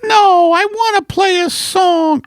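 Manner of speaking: high-pitched put-on wife voice; wife character. Wife corrects her husband about the vaccine, high character voice. Playing wife Margaret in sketch.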